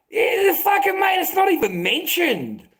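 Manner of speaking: shouting in real outrage, pitch flying up; furious yell of disbelief; outraged shout. Real, not acted. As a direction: let the anger burst out at the injustice. Angry rant about unfair treatment.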